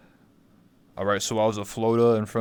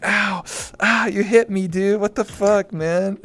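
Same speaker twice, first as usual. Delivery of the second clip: much higher, loud hammed-up pain; fake hurt; 10 semitones higher, 5 dB louder, slower than usual. Faking pain to a drunk who swings at him. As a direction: overact being punched. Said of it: "I'd be like".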